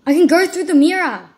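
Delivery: loud, thrilled outburst; excited shout. Real, not acted. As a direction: shout it out in triumph. Sudden discovery in game.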